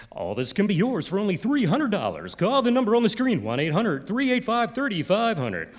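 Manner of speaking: high, swooping radio ad pitch; fake DJ voice. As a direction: sell it like an overblown radio DJ. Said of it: "with that fake D J voice".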